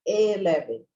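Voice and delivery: ELEVEN starting with a full 'eh', 'eh-LEH-vee'; mispronounced eleven with open first E; wrong first E. Shown as a demonstration. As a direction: say eleven the Brazilian way, clear E up front. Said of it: "a gente não pronuncia esse, esse primeiro E como".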